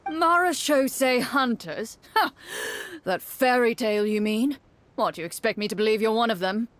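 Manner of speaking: a hint of a guard captain, firm and wary; lightly voiced captain laying down an ultimatum; stern guard captain. Captain warns the sneaking outsiders, lightly stern.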